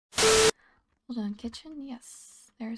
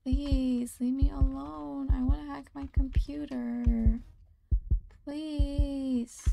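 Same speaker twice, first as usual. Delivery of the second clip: louder, drawn-out plea; pleading; 3 semitones higher, 5 dB louder, slower than usual. Real, not acted. Player begs to be left to hack, loud drawn-out pleading. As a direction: beg loudly, stretching out each please.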